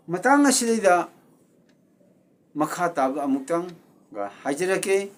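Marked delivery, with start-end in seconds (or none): none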